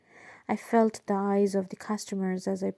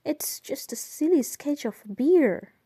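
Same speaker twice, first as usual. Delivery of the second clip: higher, incredulous protest; 6 semitones higher, 4 dB louder than usual. A narrator's reading. Woman pleading with the officer over a sketch, incredulous. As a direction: protest in disbelief, pitch jumping up. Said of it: "I was incredulous".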